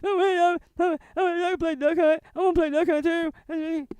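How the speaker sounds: put-on high, whining child voice; squeaky kid pleading for a game; whiny little kid. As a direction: whimper like a kid denied his game. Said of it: "stare at that console going".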